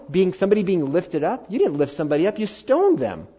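loud, high-pitched preaching push; fiery pulpit build; preaching at full force. Acted. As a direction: drive it home to the congregation.

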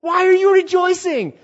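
very high, loud protest; acted wail of bewildered complaint; indignant cry. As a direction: cry out in outraged disbelief. Acts indignant sufferer questioning God.

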